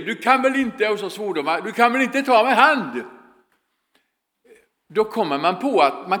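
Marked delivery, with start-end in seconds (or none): 0.0-0.9 mock yell. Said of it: "någon som skriker". 1.6-3.0 put-on raised, indignant protest from a kid on the pitch; high-pitched complaint, half shouted; mock yell. Preacher voicing a boy shouting at a teammate, mock yell. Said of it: "någon som skriker".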